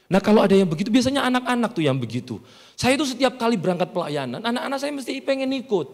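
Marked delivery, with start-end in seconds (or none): none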